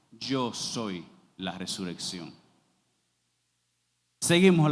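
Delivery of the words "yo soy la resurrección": low, slow and grave; measured, reverent declaration; solemn Jesus quote. Acted. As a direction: let the words of Jesus fall slowly from deep down. Preacher voicing Jesus again.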